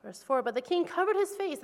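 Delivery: ordinary talk, nothing marked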